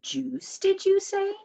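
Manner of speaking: high, eager question; excited, rising cry of surprise; surprised cry. A narrator's reading. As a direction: perk up at the word juice. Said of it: "cried Lurch".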